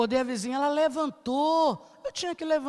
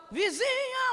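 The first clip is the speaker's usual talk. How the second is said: high calling; 10 semitones higher, 3 dB quieter, much slower than usual. Retold or imitated. Calling out to the neighbour.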